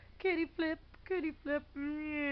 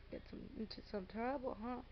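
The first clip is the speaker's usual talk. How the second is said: ordinary talk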